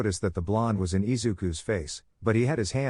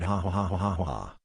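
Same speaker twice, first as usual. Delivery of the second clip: satisfied cackle of glee; gloating laugh. Real, not acted. Narrator delighting in the rival's defeat, gloating laugh. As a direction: laugh like you just won.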